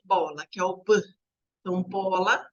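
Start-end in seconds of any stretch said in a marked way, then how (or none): 0.8-1.0 "B" said as its bare sound, a short "buh"; initial letter sound. Shown as a demonstration. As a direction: sound out "B" instead of naming the letter. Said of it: "o mesmo som inicial de". ("B" dito como som, não como nome da letra)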